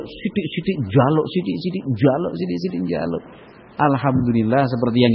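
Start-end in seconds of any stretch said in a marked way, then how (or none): 0.1-3.2 mock grumble. Mocking grumble about constant requests.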